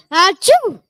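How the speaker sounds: loud sudden sneeze; big cartoon sneeze. Character sneezes.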